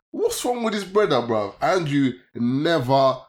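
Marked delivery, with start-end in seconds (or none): 0.1-1.5 high, loud, incredulous; baffled mock question. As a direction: ask it like you can't believe the guy. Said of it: "I'm like".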